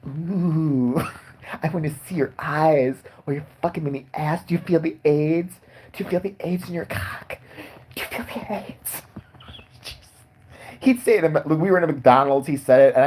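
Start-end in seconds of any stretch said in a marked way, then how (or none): 0.1-9.0 rising, creepy voice sliding up to a breathy whisper; mock-sleazy taunt getting higher and hushed; creepy friend impression. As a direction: creep him out more with every line. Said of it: "he started doing it all day".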